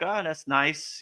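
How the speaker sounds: ordinary talk, nothing marked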